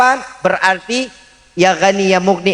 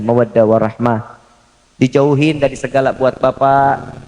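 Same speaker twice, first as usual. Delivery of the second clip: ordinary talk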